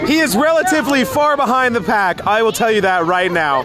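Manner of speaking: excited play-by-play announcer; race commentator. Pretend. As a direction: call the race like a sportscaster.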